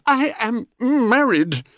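high, slow muffled protest; garbled words round seeds and pulp; mouth full. A narrator's reading. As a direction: protest through a mouthful of squashy fruit. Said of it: "through the seeds and pulp".

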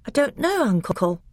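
put-on small boy unsure of himself; young boy voice. A narrator's reading.

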